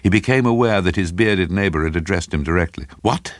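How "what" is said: high, sharp query; impatient. A narrator's reading. Craven to his bearded neighbour, impatient. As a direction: cut in impatiently, pitched up. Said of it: "he asked impatiently".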